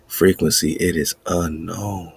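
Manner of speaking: ordinary delivery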